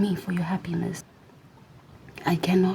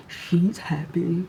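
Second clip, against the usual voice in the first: slow, drawn-out imitation of onlookers; mock dismay; much slower than usual. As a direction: mimic a nosy watcher, stretching it out. Mimicking resentful watchers of her.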